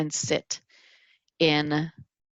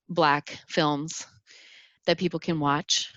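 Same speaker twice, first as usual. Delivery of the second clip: ordinary delivery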